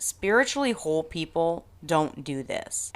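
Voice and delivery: usual speaking voice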